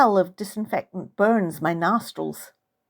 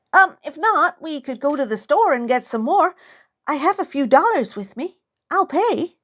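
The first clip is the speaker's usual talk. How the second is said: nervous eager offer; 7 semitones higher than usual. Acted. Nervous pleading offer to aunt.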